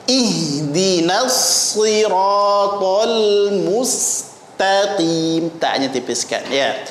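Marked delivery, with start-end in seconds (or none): 0.1-5.5 very slow, drawn-out recitation; slow model reading. Shown as a demonstration. Teacher reads the verse once more, slow and measured. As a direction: stretch every syllable, ta kept thin. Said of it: "Sekali lagi kita baca".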